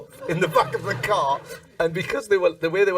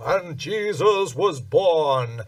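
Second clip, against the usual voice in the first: slow, grand announcer voice imitating the show; booming it out like the nativity narrator; pompous narrator; much slower than usual. A comedian mimics the Radio City narrator, slow and grand.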